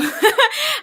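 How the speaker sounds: a loud high burst of sheepish laughter; giggling self-consciously; high embarrassed giggle. Real, not acted. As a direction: laugh shyly at yourself, high and bright. Embarrassed laugh about own language.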